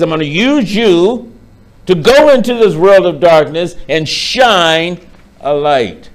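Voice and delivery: loud, high sermon delivery building in force; emphatic preaching. Real, not acted. Preacher urging the congregation to be the light, emphatic preaching. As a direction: preach it out, stretching the key words.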